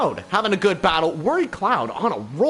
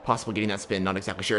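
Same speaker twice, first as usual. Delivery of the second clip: usual speaking voice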